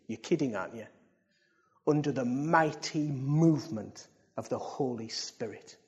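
low, soft, solemn statement; hushed earnest preaching. Real, not acted. Preacher tells the church why it exists, hushed and earnest. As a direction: drop the voice to press the mission home.

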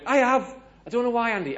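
high, loud insistence on a lie; retold indignant fib; protesting bluff. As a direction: protest it loudly, pitched right up. Acting out doubling down on lie.

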